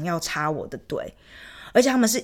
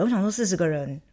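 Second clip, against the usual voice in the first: ordinary delivery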